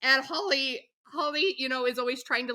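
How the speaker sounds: normal talking voice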